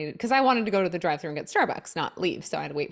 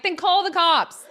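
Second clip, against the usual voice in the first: sassy; 9 semitones higher, 6 dB louder, slower than usual. Retold or imitated. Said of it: "I'm a little sassy".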